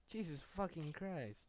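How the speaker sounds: normal talking voice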